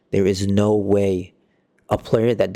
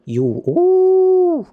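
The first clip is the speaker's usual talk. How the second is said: exasperated shriek; 6 dB louder than usual. Real, not acted. Fuming at a poor player.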